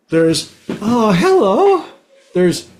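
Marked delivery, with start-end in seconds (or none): 0.8-1.8 high, slow, drawn-out hello; sing-song surprised hello; a way of saying hello. Shown as a demonstration. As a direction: greet them with a lilting rising hello. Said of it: "many, many ways of saying hello".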